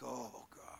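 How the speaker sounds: soft, weary mock groan; imitating a bored daughter; eye-rolling groan. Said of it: "They're like".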